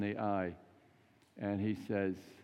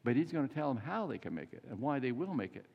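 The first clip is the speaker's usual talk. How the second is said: normal talking voice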